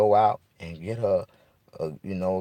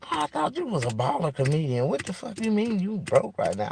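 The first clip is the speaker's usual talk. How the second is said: mocking woman's voice. A woman scoffing at the broke comedian, in a high mocking put-on voice.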